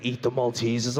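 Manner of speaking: a deep devilish voice from the back of the head; sly low craving voice, dragged out; impulse control voice. Acted. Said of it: "He's like".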